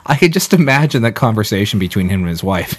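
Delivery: ordinary delivery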